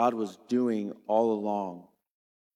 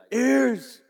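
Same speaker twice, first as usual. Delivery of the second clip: much higher, loud call; 12 semitones higher, 5 dB louder than usual. Retold or imitated. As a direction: snap the kids to attention. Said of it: "and I'd be like".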